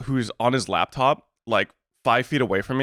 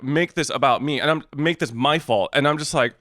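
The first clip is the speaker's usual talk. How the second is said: ordinary delivery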